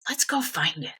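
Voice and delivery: Dad's settled, matter-of-fact suggestion; retold fatherly proposal at ordinary pitch; imitated dad. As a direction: propose it evenly, as the father would. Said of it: "one Saturday afternoon, he said".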